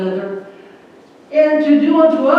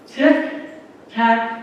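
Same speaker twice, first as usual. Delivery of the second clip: "Tic-tac-toe" drawn out word by word; slow, deliberate; much slower than usual. Shown as a demonstration. Presenter announcing competitive tic-tac-toe, "Tic-tac-toe" slow and deliberate. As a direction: say "Tic-tac-toe" slowly, a gap after each word. Repeats the game's name for effect.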